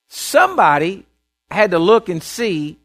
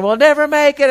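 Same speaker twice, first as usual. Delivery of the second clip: high, loud mock wail of despair; a frightened disciple crying out in the storm, despairing high wail; 9 semitones higher, 5 dB louder than usual. Pitched-up hopeless cry. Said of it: "somebody else is saying".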